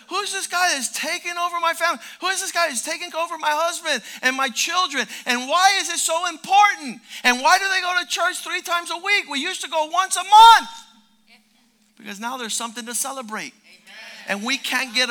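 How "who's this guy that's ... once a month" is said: upset complaint. Retold or imitated. Upset opponent's complaint voiced.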